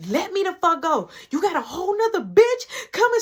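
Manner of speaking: put-on high-pitched indignant girlfriend; imitated angry yelling. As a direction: act out an upset woman telling him off. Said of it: "you can do all that screaming".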